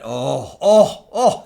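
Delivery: thrilled at what the zoom shows; delighted oh. Real, not acted.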